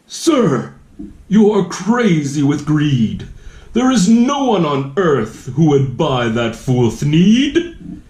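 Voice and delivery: sharp little guardian telling him off; bossy Lorax. A narrator's reading. Lorax scolding the greedy Once-ler, sharp and bossy.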